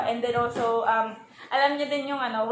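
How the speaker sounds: normal talking voice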